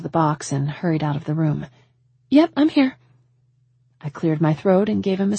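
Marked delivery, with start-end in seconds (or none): 2.3-3.0 high, loud reply; calling back. A narrator's reading. Woman answering the man's shout, calling back. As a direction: call it out to the next room.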